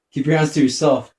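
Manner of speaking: loud mock scolding at the enemy. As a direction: bark it like a cross teacher. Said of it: "Why do you think the teachers used to tell you that?".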